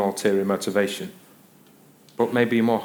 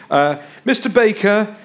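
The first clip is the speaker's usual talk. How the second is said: high, raised-voice waiter addressing a gentleman; imitated waiter, pitched up; deferential waiter; 10 semitones higher, 5 dB louder than usual. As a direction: call out formally, like a waiter to someone's dad. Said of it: "like they're talking to your dad".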